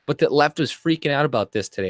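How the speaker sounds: ordinary talk, nothing marked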